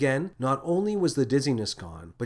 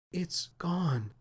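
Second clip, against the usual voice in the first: small, higher, softened voice; quiet, high note of upset; choked up; 6 semitones higher, 5 dB quieter than usual. A narrator's reading. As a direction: say it past a lump in the throat. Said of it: "swallowing the lump in her throat".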